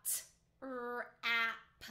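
g-r-ate said sound by sound, slowly, each sound apart; chopped into sounds. Shown as a demonstration. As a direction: break g-r-ate into its separate sounds for the class. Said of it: "I'm going to chop the word first".

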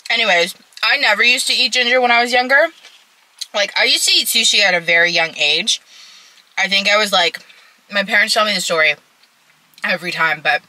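talking while chewing; speaking between bites of food; mouth full. Real, not acted. Seen 2: Fork repeatedly goes to mouth; she chews throughout the labelled words.

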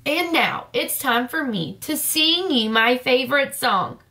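ordinary delivery, nothing marked

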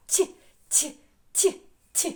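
short isolated soft ті, repeated in separate bursts; soft consonant. Shown as a demonstration. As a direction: tap out a soft palatalised ті. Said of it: "Теж м'який". (м'який короткий звук ті, окремо)

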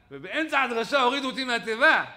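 loud, high indignant complaint; aggrieved outburst. Acted. Preacher tells of being pulled off the pulpit, aggrieved outburst. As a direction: protest the humiliation at full voice.

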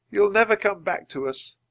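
high, loud lament; grieving. Acted. Teddy knows Penny is lost, grieving. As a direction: give up hope out loud, hurt.

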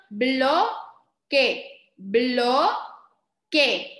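"bloque" split into BLO... KE; deliberate two-beat sounding-out of "bloque"; syllable by syllable. Shown as a demonstration. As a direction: hold BLO, pause, then KE in "bloque". Teacher sounds out word by syllables.

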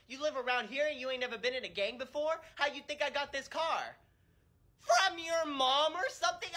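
put-on dumbfounded teen. Coworker voiced, dumbfounded.

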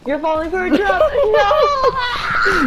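high, loud laughing panic; laughing yell. Real, not acted. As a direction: shout through laughter. Warning teammate, laughing panic.

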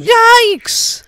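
loud, very high cry of alarm; sudden comic shock; startled yelp. Acted. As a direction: yelp as if horrified by what you see. Father startled by overloaded cart.

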